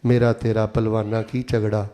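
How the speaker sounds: low, even rhythmic verse; recited poem. Acted. (ਨੀਵੀਂ ਸੁਰ ਵਿੱਚ ਸ਼ਾਇਰੀ)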